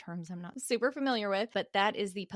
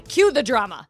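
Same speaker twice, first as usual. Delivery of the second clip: loud dramatic call; 4 semitones higher, 14 dB louder than usual. Acted. Mock producer command.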